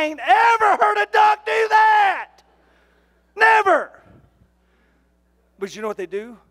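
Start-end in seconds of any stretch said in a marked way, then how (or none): 0.0-4.0 high, loud, slow and disbelieving; incredulous shout. Retold or imitated. Preacher doubting the championship duck callers, loud and disbelieving. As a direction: bellow your disbelief. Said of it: "and I thought".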